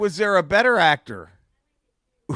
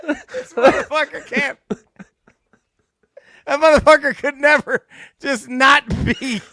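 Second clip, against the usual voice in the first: higher, tearful with laughter; laughing helplessly; 9 semitones higher, 7 dB louder than usual. Real, not acted. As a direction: barely get the words out for laughing. Cracking up over the impression.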